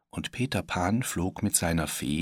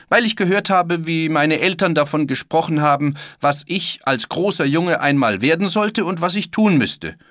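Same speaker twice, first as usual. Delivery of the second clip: put-on loud, high, impassioned boy's outburst; high-pitched, vehement child's declaration; defiant boy Peter Pan; 10 semitones higher, 9 dB louder than usual. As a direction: protest like a boy refusing to grow up. Voices Peter's passionate refusal.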